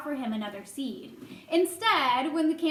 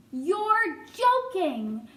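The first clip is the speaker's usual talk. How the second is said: drawn-out, higher, louder disbelief; incredulous; 5 semitones higher, 5 dB louder, much slower than usual. A narrator's reading. Boy to a mouse claiming a motorcycle, incredulous. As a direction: stretch the words in disbelief.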